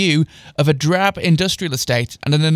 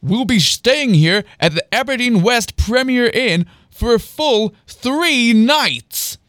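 higher, showy announcement with drawn-out ending; manic grin; 6 semitones higher, 3 dB louder, slower than usual. A narrator's reading. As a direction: announce it grinning, stretching the finish. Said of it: "kept his manic grin as he delivered the reply".

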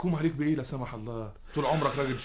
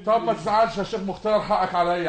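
high, loud desperate begging; high, loud, urgent pleading; frantic pleading; 12 semitones higher, 8 dB louder than usual. Acted. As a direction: chase after him, begging. Pleading with the angry sheikh.

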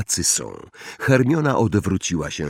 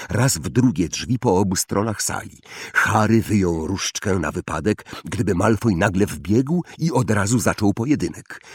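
plain reading voice

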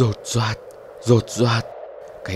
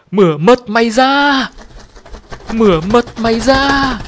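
much higher, louder screech, slowing at the end; shrill screeching bird; 11 semitones higher, 6 dB louder than usual. A narrator's reading. The bird stares at the narrator and screeches, shrill and menacing. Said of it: "rít lên re ré".

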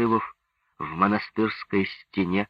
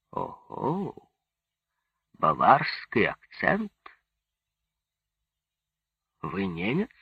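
mildly surprised, questioning lift at the end; surprised general; 4 semitones higher, slower than usual. A narrator's reading. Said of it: "удивился генерал".

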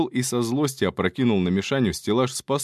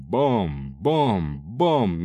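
slow, lower bom-bom-bom; imitated clanging; 3 semitones lower, much slower than usual. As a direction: mimic the ladle blows. Ladle striking the head.

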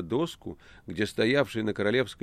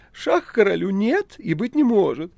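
loud, high-pitched cat protest; affronted talking cat; indignant cat Behemoth; 13 semitones higher, 9 dB louder than usual. Acted. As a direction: deny it in a squealing cat voice. Cat denies the check.

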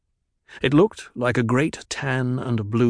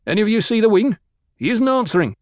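loud, much higher angry shout over the radio; 11 semitones higher, 6 dB louder, faster than usual. Acted. A crawler man shouting angrily over the radio about the missing wing. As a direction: snap at everyone listening, alarmed. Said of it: "an angry voice".

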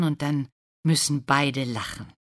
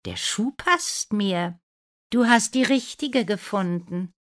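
sweet-talking stepsister; 7 semitones higher, 4 dB louder than usual. A narrator's reading. Bella shows the prince her foot, high and sweet. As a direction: present yourself sweetly, pitched high.

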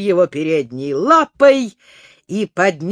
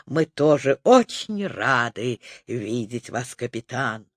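low animal chorus; 9 semitones lower, 5 dB quieter than usual. Acted. Animals answer together.

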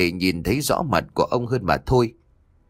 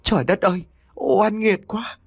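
wailing cry; 11 semitones higher than usual. A narrator's reading. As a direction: cry out to the heavens in grief. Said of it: "bà liền rú lên".

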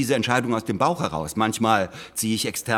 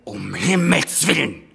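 exclamation; 4 semitones higher, 3 dB louder than usual. Acted. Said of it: "rufe ich da".

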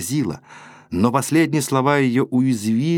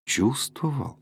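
softer, inward question; murmured doubt to himself; asking himself; 3 semitones higher, 5 dB quieter, slower than usual. Acted. Rudin alone, questioning his own love, softly. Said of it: "спросил он самого себя".

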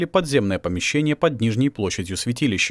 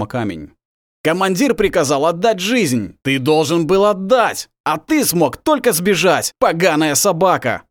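furious; 5 semitones higher, 4 dB louder than usual. Acted. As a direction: bellow contempt at the traitor. Wolf berates beaten traitor.

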